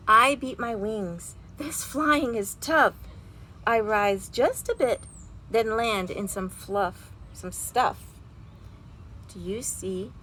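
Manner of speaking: lively storybook read-aloud with big feelings; reading to children. A narrator's reading. Teacher reading a picture book aloud to her class, animated. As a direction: read it like a bedtime story for kids.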